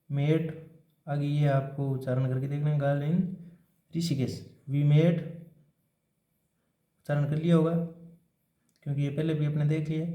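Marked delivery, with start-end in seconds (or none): none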